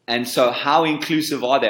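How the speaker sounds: ordinary talk, nothing marked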